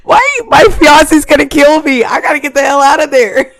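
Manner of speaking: loud, high, laughing through the words; flustered laughing. Real, not acted. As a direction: blurt it out, flustered and giggling. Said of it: "my cheeks are hot".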